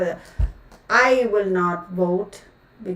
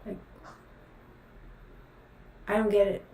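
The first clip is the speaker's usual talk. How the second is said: usual speaking voice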